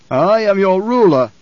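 slow, high, loud declaration of rank; imperious emperor. Acted. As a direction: pull rank, loudly and slowly. Emperor asserting his rule.

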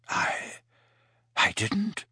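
soft, breathy, barely voiced; hushed. A narrator's reading. Slughorn hushed after hearing of Harry's father's death. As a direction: let it slip out hushed and shaken. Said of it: "in a hushed voice".

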